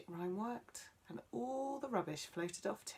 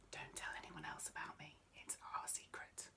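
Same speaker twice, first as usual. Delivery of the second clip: secretive whisper; 3 semitones lower, 9 dB quieter than usual. Acted. Tree shares a secret.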